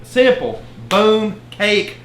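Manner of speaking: high punchy exclamations; excited. Real, not acted. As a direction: bark out each word with glee. Exclaims after a demo.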